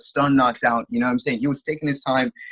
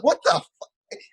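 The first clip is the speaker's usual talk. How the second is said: high-pitched, incredulous outburst; stunned disbelief; 8 semitones higher, much slower than usual. Retold or imitated. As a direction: relive the shock at the screen. Retold shock at knockout.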